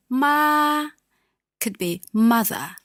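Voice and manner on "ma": "ma," held high and level; high flat first tone. Shown as a demonstration. Teacher showing first tone for mother, high flat. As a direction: sing "ma," on one high steady note. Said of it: "first tone".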